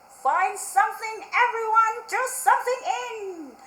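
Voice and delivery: high, loud, with a chuckle; grinning witch. Acted. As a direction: cheerfully invite everyone. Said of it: "said with a grin".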